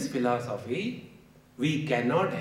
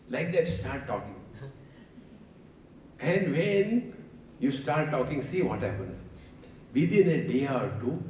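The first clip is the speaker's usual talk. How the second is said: usual speaking voice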